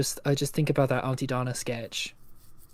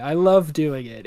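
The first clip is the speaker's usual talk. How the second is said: ordinary delivery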